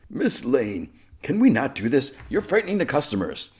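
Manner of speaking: high-pitched mock pleading; flustered pleading. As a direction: play a flustered shopkeeper begging her to stop. Flustered merchant pleads with Lois.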